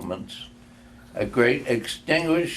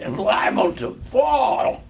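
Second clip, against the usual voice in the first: frightened protest; 10 semitones higher than usual. A narrator's reading. Scrooge pleads with the ghost in a high fright. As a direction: cry out in a fright, stammering. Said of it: "Scrooge remonstrated".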